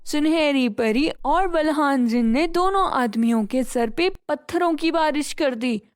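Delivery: plain reading voice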